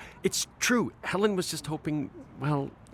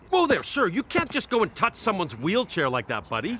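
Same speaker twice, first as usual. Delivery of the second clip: indignant; 6 semitones higher than usual. Acted. Confronting the officer.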